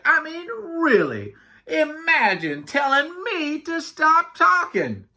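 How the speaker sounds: high, slow, affronted talker; indignant Yak. Acted. Yak complaining about Camel's order, indignant. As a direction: draw it out in offended disbelief.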